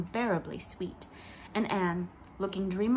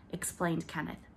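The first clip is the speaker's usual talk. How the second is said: usual speaking voice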